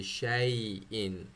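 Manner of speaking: slow शाई इन with the y drawn out; full ya sound. Shown as a demonstration. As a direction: stretch शाई इन slowly. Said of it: "या की आवाज़ मुकम्मल आनी चाहिए".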